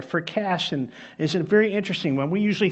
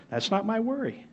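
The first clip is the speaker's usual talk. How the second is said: calm; 3 semitones higher, 7 dB quieter than usual. Retold or imitated. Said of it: "the man calmly said".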